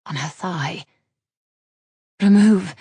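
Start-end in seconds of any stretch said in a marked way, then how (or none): 2.2-2.7 loud, abrupt single-word order; sharp command. A narrator's reading. Daughter halting the smith's caress, loud sharp command. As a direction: bark it to stop him. She halts his caress.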